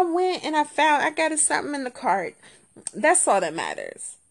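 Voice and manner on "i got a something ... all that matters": ordinary delivery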